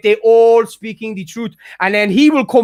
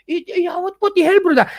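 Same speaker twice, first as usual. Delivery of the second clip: mock whine; 7 semitones higher than usual. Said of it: "he would instantly go to D Ms and go".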